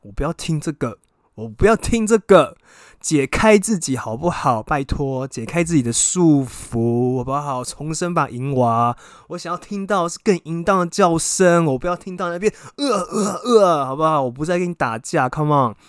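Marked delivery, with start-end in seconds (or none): 0.0-2.6 rising to a full shout; loud mock outburst, louder on the repeat; loud insistent. Retold urging him, rising. 3.0-12.5 loud, slow, drawn-out pleading; theatrical loud encouragement; loud coaxing. Retold or imitated. Retold coaxing him loudly. 12.8-13.9 high strained mock groans; mock the sounds he made; hammed-up grunting; mimicked groans.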